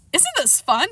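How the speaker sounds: high happy voice; giddy with the game; high gleeful puppy. A narrator's reading.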